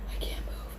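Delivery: whispered, frozen in fear; frightened whisper. Retold or imitated. Frozen on the stairs to a friend, frightened whisper. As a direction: whisper it without stirring.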